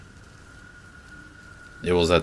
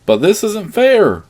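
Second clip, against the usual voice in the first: high, loud tearful wail; bawling; 8 dB louder, slower than usual. A narrator's reading. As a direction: wail it out slowly. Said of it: "my father bawled out".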